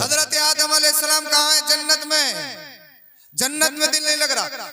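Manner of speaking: high, loud rhetorical oratory; full-force preaching. Acted. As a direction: proclaim it to the whole crowd, high and loud. (पूरे ज़ोर की बुलंद तक़रीर)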